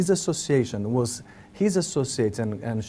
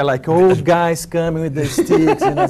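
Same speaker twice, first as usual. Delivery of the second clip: laughing; 4 semitones higher, 8 dB louder than usual. Real, not acted. Laughing at funny memory.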